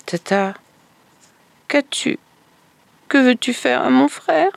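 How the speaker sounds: put-on worried little girl. Girl questions stepmother fearfully.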